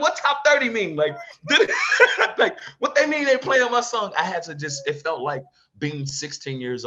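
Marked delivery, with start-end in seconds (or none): 0.0-4.1 high, loud, laughing mock confusion; laughing disbelief. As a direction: laugh through your own amazement. Retells disbelief at chart news.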